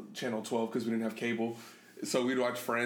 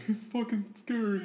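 higher, joking fearful admission; mock scared; 8 semitones higher than usual. Son joking about fear of his dad, mock scared. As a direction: play up being afraid of dad. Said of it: "scared of my dad. I'm like".

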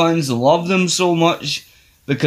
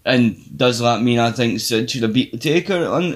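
ordinary talk, nothing marked

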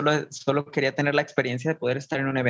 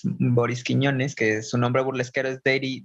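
ordinary delivery

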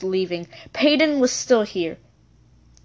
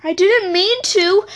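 trembling voice; 7 semitones higher, 4 dB louder, slower than usual. Acted. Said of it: "in a trembling voice".

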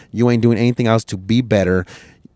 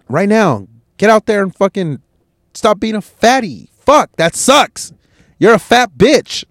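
angry rant; 7 semitones higher than usual. Real, not acted. Ranting at the overweight viewer.

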